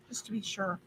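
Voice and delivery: ordinary talk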